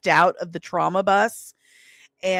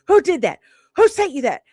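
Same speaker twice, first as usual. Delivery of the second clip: higher, louder furious demand; angry Dave; 8 semitones higher, 6 dB louder, faster than usual. Retold or imitated. Husband Dave calling about the headline, furious and loud. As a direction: bark it like an outraged husband. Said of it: "I've never heard Dave so angry".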